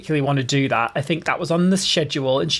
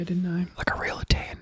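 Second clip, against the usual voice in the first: hushed, voiceless aside; whispered boast; 7 dB quieter than usual. Pretend. Host praising their own look in that video, whispered.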